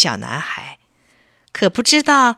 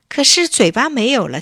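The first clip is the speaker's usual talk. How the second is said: usual speaking voice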